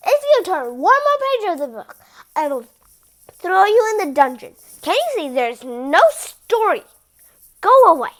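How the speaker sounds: loud angry royal threat; furious king bellowing; furious shout. Acted.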